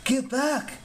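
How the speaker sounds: high-pitched, urgent warning; warning cry. A narrator's reading. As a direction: warn your friends off. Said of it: "Frank warned".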